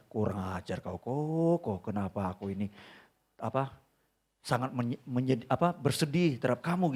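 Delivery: low, soft inner grumble; quiet low mutter inside the head; said in my heart. Retold or imitated. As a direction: murmur it under your breath to a lost friend. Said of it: "di hati saya".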